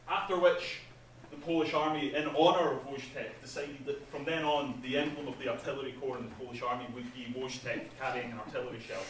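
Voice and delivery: ordinary talk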